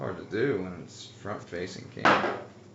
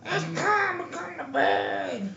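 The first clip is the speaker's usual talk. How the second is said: nagging wife. Acted. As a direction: shriek him to bed like a nagging wife. Plays nagging wife Shannon.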